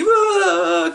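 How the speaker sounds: high-pitched pretend wail; mock crying wail. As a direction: wail in a put-on cry. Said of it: "that's all making me, uh, cry".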